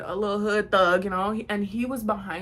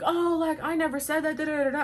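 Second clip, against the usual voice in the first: higher, whiny denial; mock girl denying; 7 semitones higher than usual. Mocking the girl's denial of what she said. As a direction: imitate her weaselly denial.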